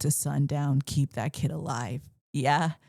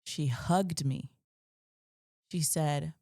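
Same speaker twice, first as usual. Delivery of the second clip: ordinary talk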